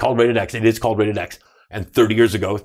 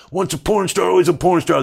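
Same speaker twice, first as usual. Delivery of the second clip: higher retold jeering chant at him; mock crowd yell; 8 semitones higher, 4 dB louder than usual. Crowd jeering the retiring star, raised mock chant. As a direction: echo the crowd taunting him, pitched up. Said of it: "they'd yell at me".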